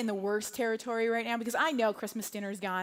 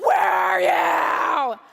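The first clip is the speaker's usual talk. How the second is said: drawn-out full-volume shout; frantic parent's long yell; 11 dB louder, much slower than usual. Retold or imitated. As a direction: holler for a lost child, stretching each word. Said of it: "I was just yelling".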